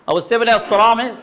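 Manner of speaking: normal talking voice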